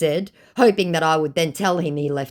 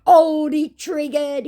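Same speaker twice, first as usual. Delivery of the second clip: mock scammer taunt; 11 semitones higher, 5 dB louder, slower than usual.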